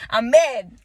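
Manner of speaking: loud, furious and raw; swearing in real anger; real angry outburst. Real, not acted. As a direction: let the fury out, full volume. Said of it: "I'm fucking mad".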